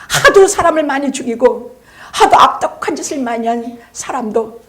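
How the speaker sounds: loud, high, impassioned proclamation; full-force preaching. Real, not acted. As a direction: thunder the assurance out to the congregation. (목청 높여 열변하는 설교)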